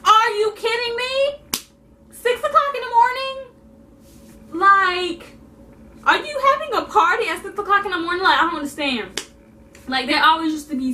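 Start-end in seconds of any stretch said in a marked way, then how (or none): 0.1-8.2 furious rant at the noisy neighbours; shouted at the party upstairs at dawn, outraged; outraged disbelief. Retold or imitated. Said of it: "I'm like".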